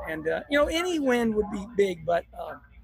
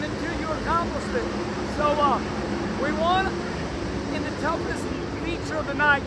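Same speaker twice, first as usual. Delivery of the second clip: excited; 6 semitones higher than usual. Real, not acted. Excited victory selfie video.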